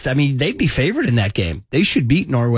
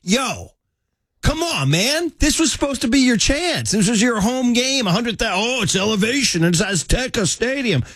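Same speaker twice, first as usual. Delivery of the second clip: exasperated rant; 7 semitones higher than usual. Real, not acted. Exasperated rant at Mexico fans.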